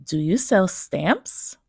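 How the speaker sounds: slow question rising sharply on "stamps"; rising intonation. Shown as a demonstration. Teacher demonstrating rising questions, pitch up on "stamps". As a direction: let the pitch climb at the end on "stamps". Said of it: "sentences with a rising intonation".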